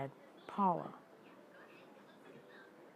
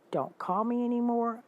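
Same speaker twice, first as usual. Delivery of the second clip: louder, higher, final and cold; angry cutoff; 6 semitones higher, 6 dB louder than usual. Retold or imitated. Telling her friend never to call again, louder and angry. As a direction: slam the door on her with the words, loud and high. Cutting off friend in retold quarrel.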